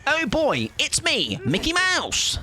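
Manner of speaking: high squeaky cartoon mouse; chirpy Mickey introducing himself. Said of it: "I think is what he said".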